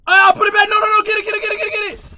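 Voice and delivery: frantic scared yelling; panicked scream. Real, not acted. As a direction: shriek in sudden panic at the jump scare. Jump scare in horror game.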